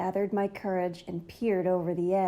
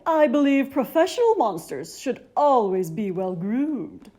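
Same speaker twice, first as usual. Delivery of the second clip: Ralph's voice; 6 dB louder than usual. A narrator's reading. Ralph the groomed monster.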